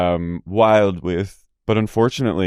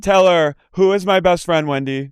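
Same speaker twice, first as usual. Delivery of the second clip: insistent caller; 8 semitones higher than usual. Retold or imitated. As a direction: press Wendy for an answer. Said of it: "She's like".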